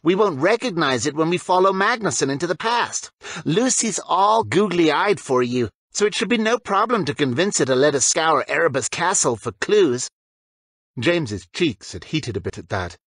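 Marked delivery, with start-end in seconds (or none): 0.0-10.1 a slightly higher, teasing schoolboy voice; lightly voiced Zane. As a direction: tease your friend a bit higher than usual.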